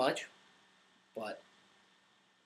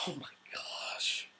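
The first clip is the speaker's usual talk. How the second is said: slow, very soft whisper; mortified whisper; 12 dB quieter, slower than usual. Retold or imitated. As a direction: whisper it slowly in hushed horror. Repeats the shock under his breath.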